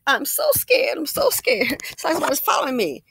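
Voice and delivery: very high, loud whimpering fake fright; imitating the scared old lady at the door; hammed-up terror. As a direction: mimic her frightened act in a squeaky voice. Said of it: "she went from".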